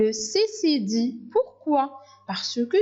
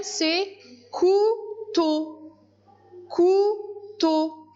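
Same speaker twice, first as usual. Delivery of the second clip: couteau said high and drawn out; high, slow dictating voice; high slow dictation; 7 semitones higher, much slower than usual. Shown as a demonstration. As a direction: dictate to children, lifting the word. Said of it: "dictation of word, high, slow".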